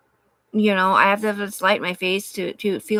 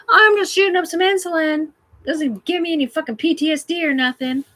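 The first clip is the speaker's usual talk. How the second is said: higher, louder put-on voice; mock junkie voice; 10 semitones higher, 6 dB louder than usual. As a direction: ham up a flippant addict. Sarcastic mock addict voice.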